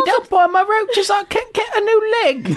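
shrill high-pitched whine; high squeaky voice. Acted. High screechy voice role play.

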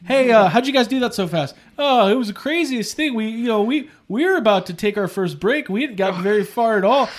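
hammed-up Canadian accent; Canadian mechanic. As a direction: recount it as a folksy Canadian worker. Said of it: "Yeah, they're Canadian".

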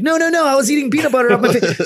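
high, rushed protest; desperate to explain himself; frantic pleading. Retold or imitated. Explaining to the recruit at the stall, frantic pleading.

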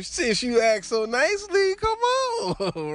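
high, mock-gracious acceptance; friend giving in. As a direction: imitate a buddy happily obliging.

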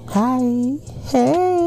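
high, bubbly hello; excited greeting. Real, not acted. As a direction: sing out a cheery hi. Bright greeting to viewers.

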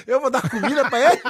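mock fury breaking into laughter; laughing outrage. Pai indignado com o jantar. (pai indignado rindo)

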